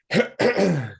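a short, rough clearing of the throat. Real, not acted. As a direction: clear your throat once before going on. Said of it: "clears throat for the first time".

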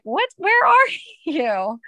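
high, drawn-out mock bewilderment with a laugh; baffled pupils. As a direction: imitate puzzled kids, slow and loud. Retelling pupils' baffled reaction.

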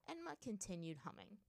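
ordinary delivery

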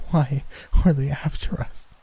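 high, frightened pleading question; voice shaking. A narrator's reading. Terrified girl asking why corpses are chasing them, voice shaking. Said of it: "her voice shaking".

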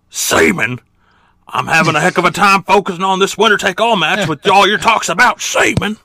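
loud, high exasperated commentator impression. Commentator snapping at his partner's distracting talk, loud mock exasperation. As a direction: blurt it out, hammed-up and fed up. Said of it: "and J R says".

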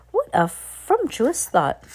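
high, slow gleeful witch; delighted witch. Acted. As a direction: savour it like a crone.

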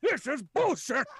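put-on high, loud, furious old prisoner; angry ranting. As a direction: rant like a caged madman. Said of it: "it's like, it's like".

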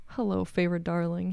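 soft, unvoiced, seductive whisper; seductive purr. A narrator's reading. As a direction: purr it like a tempting demon. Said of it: "he purred".

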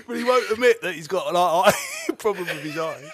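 usual speaking voice

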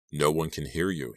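usual speaking voice

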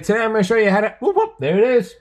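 very high, loud Australian video-host voice; Aussie Cossack. Retold or imitated. Host mimicking an Aussie YouTuber's intro, loud and excited. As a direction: break off into high excited whoops. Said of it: "he's the Aussie Cossack".

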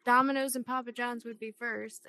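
ordinary delivery

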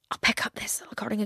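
softly whispered aside; whispered thought. Retold or imitated. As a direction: whisper it to yourself like a small secret. Quoting own shopping thought.

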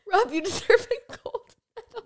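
high voice breaking into laughter; laughing. Real, not acted. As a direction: tease him through giggles. Laughing at the story.

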